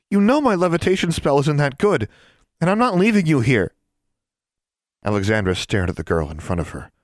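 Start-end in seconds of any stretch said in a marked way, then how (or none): 0.1-3.7 very high, loud strained protest; taut, near breaking. A narrator's reading. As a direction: voice stretched to snapping point. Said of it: "Anna's voice was like a taut wire about to break".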